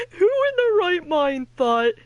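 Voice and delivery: high, loud words broken by laughter; real laughter; laughing. Real, not acted. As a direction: laugh through the question. Still laughing at fairies.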